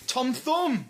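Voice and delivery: high, loud Tom Thumb!; punchline shout. Pretend. Friend shouts the pun at Tom, loud and high.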